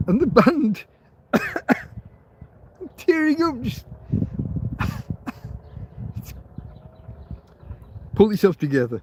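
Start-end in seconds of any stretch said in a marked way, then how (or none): 0.1-3.9 high, tearful voice cracking over the story; words pushed out through welling tears; choked up crying. Real, not acted. Retelling the [name] bar story, starting to cry. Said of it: "I don't know why I'm crying". 3.9-6.7 tearful laugh. Real, not acted. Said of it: "I don't know why I'm crying".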